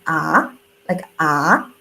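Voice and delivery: low "Ah" rising upward; rising second tone. Shown as a demonstration. Teacher demonstrating the second tone on "Ah". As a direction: lift the "Ah" from low to up. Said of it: "So also it's like".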